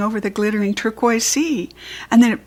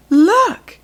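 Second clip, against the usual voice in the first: much higher, loud joyful cry; excited shout; 9 semitones higher, 9 dB louder than usual. Acted. Tiana shouting to tired friends on seeing the island. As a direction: burst out as you spot land.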